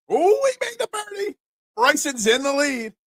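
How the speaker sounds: high, surprised exclamation. Real, not acted. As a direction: react in real disbelief. Reaction to a made birdie.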